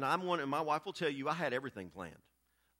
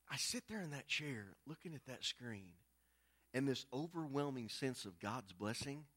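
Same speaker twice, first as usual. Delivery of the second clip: usual speaking voice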